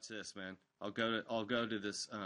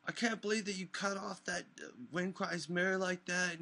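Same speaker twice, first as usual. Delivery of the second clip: much higher, whiny put-on caller voice; mock a peeved listener, pitched way up; whiny caller imitation; 9 semitones higher than usual. Mimicking an upset caller complaining on air, in a high whiny voice. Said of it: "they're like".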